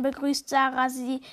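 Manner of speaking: usual speaking voice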